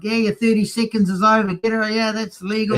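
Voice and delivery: high-pitched mock yell from the stands; heckling call, imitated without full volume; retold yelling. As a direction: re-enact yelling at the track, pitch well up. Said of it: "and I'm yelling".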